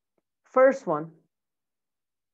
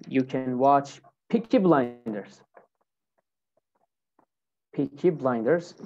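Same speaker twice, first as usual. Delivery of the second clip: ordinary talk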